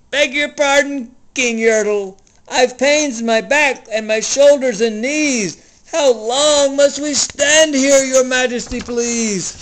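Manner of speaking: high, timid, aching plea; pleading little turtle. Acted. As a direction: beg the king gently from the bottom. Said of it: "and he said".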